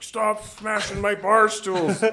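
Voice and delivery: loud, slow, high furious yell; angry bar owner. Retold or imitated. Bar owner yells at partying metalheads, slow furious shout. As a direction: bellow like a fed-up owner. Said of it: "gruff, aggressive, like, angry man".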